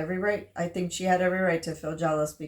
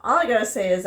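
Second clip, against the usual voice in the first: usual speaking voice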